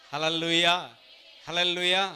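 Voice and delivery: 1 loud hallelujah call. Real, not acted. Preacher calls congregation.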